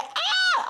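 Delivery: high mock shriek of shock; a mother's scandalized mock scream; imitated scream. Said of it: "she started screaming like".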